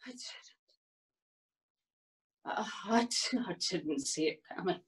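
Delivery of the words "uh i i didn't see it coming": low, very soft, quick; subdued admission. Acted. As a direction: admit it quietly, almost to yourself.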